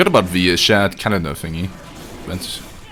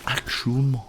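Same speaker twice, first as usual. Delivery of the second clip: softer, higher attempt at French "Actuellement"; tentative French attempt, pitched up; French pronunciation; 4 semitones higher, 6 dB quieter than usual. Shown as a demonstration. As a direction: test out "Actuellement" softly in French. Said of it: "say that in French".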